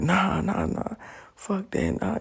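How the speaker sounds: a lightly voiced stubborn no; defiant refusal. Defiant refusal facing death.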